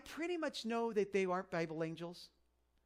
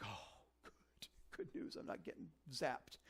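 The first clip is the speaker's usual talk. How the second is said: relieved whisper; 4 semitones lower, 12 dB quieter, slower than usual. Pretend. Relieved aside, pretend listener.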